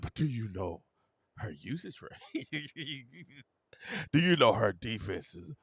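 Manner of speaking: low, soft mock lecture; condescending fan. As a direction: lecture like a smug stats nerd. Imitating fans' lecturing question.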